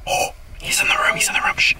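urgent hushed whisper; whispering while hiding. Real, not acted. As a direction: breathe it so nobody hears. Hiding, seeker enters room.

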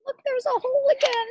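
high, loud delighted exclaim; excited exclaim. Acted. Teacher showing the children a new hole. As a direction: point it out with big excitement.

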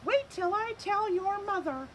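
high, odd scolding voice; scolding grown-up. Acted. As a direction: threaten to tell his mother.

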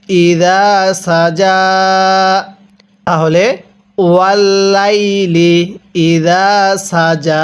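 ইদা সাজা with thin স and firm জ; slow recitation. Shown as a demonstration. Teacher models the verse slowly for students. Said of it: "সিনটা চিকন করে জিমটা শক্তভাবে".

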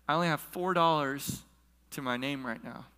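usual speaking voice

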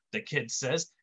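usual speaking voice

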